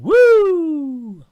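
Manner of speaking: long high "woo" scream; loud, held whoop, sliding down at the end. Acted. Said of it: "raises his forefinger and screams".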